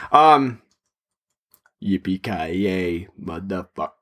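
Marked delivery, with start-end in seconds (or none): 1.8-3.9 low, slow, badly faked German accent; Hans Gruber. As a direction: drag it out deep and soft in a poor German accent. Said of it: "instead of my terrible accent".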